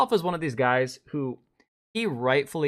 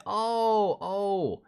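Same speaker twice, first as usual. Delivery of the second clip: sudden recognition; 7 semitones higher than usual. Real, not acted. Recognizing K-Med.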